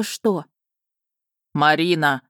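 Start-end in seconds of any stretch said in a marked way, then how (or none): 1.5-2.2 loud displeased call. Acted. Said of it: "Громкий недовольный голос дракона".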